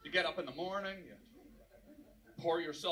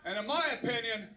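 mock furious shout; 6 semitones higher, 4 dB louder than usual. [name] on phone to Frank, building into hammed-up screaming.

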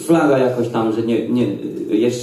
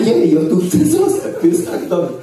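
excited pleading; 6 semitones higher than usual. Retold or imitated. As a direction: beg to stay with giddy joy, voice jumping up. Begging Jesus to let him stay. (rozradowane, wysokie błaganie ze śmiechem)

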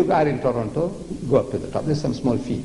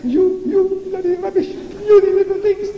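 much higher, mock outraged scolding; scornful God; 13 semitones higher than usual. Preacher voicing God scolding puny tower builders, high mock outrage. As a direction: sneer down at little humans.

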